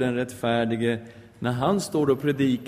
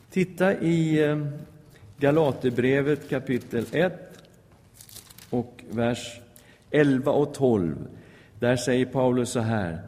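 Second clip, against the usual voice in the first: ordinary talk, nothing marked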